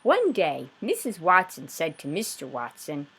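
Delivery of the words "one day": ordinary delivery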